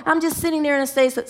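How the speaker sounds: ordinary talk, nothing marked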